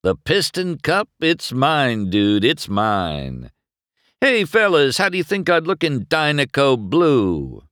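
high-pitched cocky bragging; glossy confidence. A narrator's reading. Rival claims the Piston Cup, glossily confident. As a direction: gloat to your crew. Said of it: "his voice glossy with confidence".

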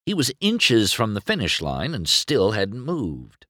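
plain reading voice

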